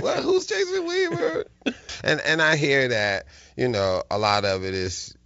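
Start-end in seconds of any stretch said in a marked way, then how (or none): 0.0-1.4 high, laughing who's that; laughing mock confusion. A partygoer asking who he is, laughing high. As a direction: play dumb through laughter.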